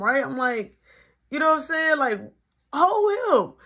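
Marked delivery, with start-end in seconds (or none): 2.7-3.5 high, emphatic "Hold him"; urgent call. Retold or imitated. Teller urging his teammate to guard Marky, high and loud. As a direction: press the order, pitched up and loud.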